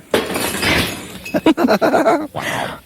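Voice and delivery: unvoiced crash noise; breathy glass-smash effect. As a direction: make a breaking glass noise. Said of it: "get past that sound effect".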